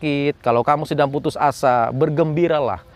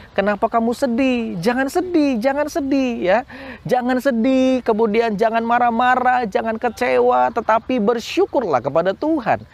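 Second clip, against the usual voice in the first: higher, soothing coaxing, don't be sad; comforting the soul; 9 semitones higher than usual. Acted. Preacher teaching kids to soothe their own soul, tender coaxing. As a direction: coax a sad child gently.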